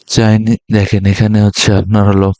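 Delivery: normal talking voice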